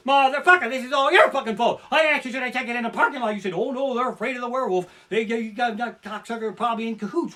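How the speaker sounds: loud, high, raging tirade; mock-furious shout at his wife; furious rant at wife. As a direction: blow up at her, screaming blame. Raging at wife, mocking her.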